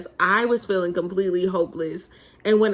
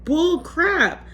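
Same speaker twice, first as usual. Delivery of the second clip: scoffing; 8 semitones higher than usual. Retold or imitated. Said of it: "And I'm thinking".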